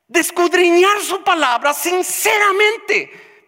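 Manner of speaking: high, loud, slow sermon cadence building line by line; preaching at full force. Acted. Pastor exhorting the congregation, preaching at full force. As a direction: pound out each phrase like a revival preacher.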